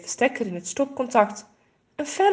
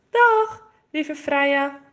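high, loud goodbye; fond farewell call; 6 semitones higher, 3 dB louder, much slower than usual. A narrator's reading. Girl calls goodbye to the bird flying away, high and fond. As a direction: call after the bird as it flies off. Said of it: "roept Renske".

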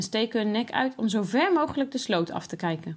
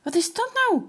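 put-on shocked little boy yelps; startled exclaim; 10 semitones higher than usual. A narrator's reading. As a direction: cry out in shock at the vanishing chick. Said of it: "roept Lucas uit".

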